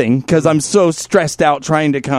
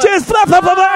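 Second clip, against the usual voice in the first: mock splatter noises; 3 dB louder than usual. Imitating spooge flying.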